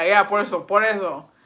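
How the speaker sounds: ordinary delivery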